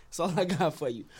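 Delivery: usual speaking voice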